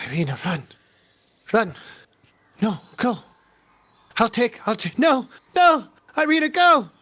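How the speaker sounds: panicked sleep-talking rising to high cries; night terror cries. Retold or imitated. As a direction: beg in a nightmare, growing frantic. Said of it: "like, this little night terror".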